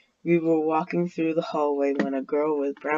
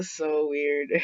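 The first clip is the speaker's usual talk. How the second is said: ordinary delivery, nothing marked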